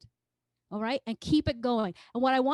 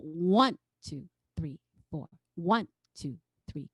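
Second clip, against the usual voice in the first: steady count, leaning on every "One" and backing off the rest; accent on one; 7 semitones lower than usual. Shown as a demonstration. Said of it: "with an emphasis on it".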